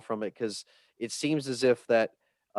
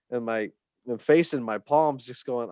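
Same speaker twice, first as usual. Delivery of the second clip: usual speaking voice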